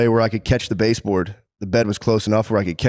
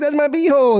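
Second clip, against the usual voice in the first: squeaky alarm. Retold or imitated. Man acting out his reaction to his wife, high squeal.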